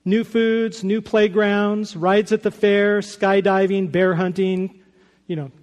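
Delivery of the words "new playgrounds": ordinary talk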